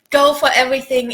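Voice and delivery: normal talking voice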